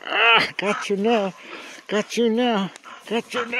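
teasing, high-pitched gotcha; playful gotcha. Real, not acted. Playful catch during a game.

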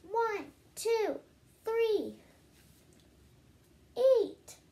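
judge yelling. Acted. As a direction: bellow the start of the race. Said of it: "and he yelled".

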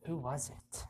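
soft, wondering question; hushed question. Acted. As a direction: ask it softly, half to yourself. Reading a mysterious game line.

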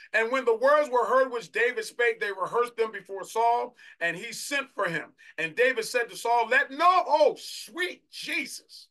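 loud, high proclamation building to an outcry; high, ringing pulpit delivery; preached scripture reading. Real, not acted. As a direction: declaim the verse at full force. Reads scripture, breaks into exclamation.

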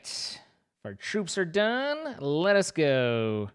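slow, stretched-out rally call; drawn-out. Acted. Dramatic go command.

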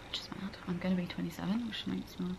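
hushed little aside; whispered aside. Real, not acted. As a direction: whisper it as a secret. Aside about turning 27.

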